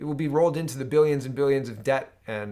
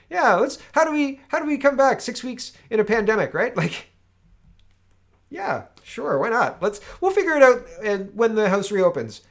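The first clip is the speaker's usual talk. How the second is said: much higher, louder mock breeziness; sarcastic mockery; 9 semitones higher, 7 dB louder than usual. Mocking Commons adjourning, high sarcastic mock. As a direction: toss it off like a careless politician. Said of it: "I'm being sarcastic".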